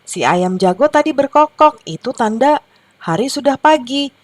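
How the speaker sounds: high storybook hen voice; lightly voiced hens. A narrator's reading. Mother hens answer the owl, lightly acted explaining. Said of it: "ujar induk-induk ayam itu".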